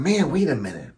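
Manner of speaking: hushed aside to himself; muttering to himself. Acted. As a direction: say it under your breath, suspicion dawning. Husband spots apple, mutters to himself.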